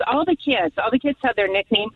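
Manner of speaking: ordinary talk, nothing marked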